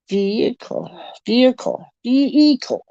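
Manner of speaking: "vehicle" repeated, vee-ee-cull, climbing in pitch; bumped "vehicle". Retold or imitated. Canadian checking how Americans say it, "vehicle" imitated with a bump. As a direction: try out "vehicle" with a bump in the middle. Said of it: "It's that little bump".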